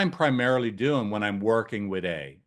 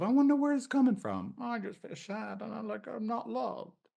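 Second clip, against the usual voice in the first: high and much softer, hurt client; acted sad client; 11 semitones higher, 7 dB quieter than usual. Shown as a demonstration. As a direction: let the client's hurt slip out high and hushed, slowing at the end. Client voiced in role-play.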